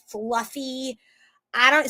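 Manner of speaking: ordinary talk, nothing marked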